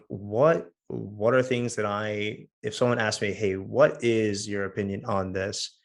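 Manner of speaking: ordinary talk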